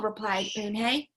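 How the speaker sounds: usual speaking voice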